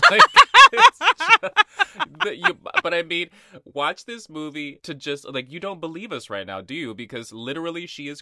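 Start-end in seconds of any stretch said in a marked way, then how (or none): 0.0-2.6 laughing. Real, not acted. Cracking up at the plot.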